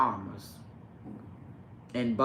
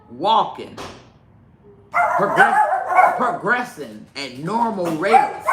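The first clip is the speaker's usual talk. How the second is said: proud bragging; 7 semitones higher, 7 dB louder, slower than usual. Real, not acted. Proud loud bragging about baby.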